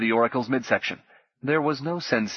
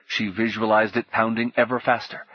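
ordinary delivery

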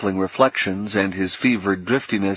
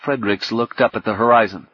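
usual speaking voice

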